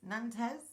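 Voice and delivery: Nantes tried as "nan-TEZ", rising; unsure pronunciation. Real, not acted. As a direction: say Nantes with an "ez" ending, questioning. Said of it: "Nantes, I don't know".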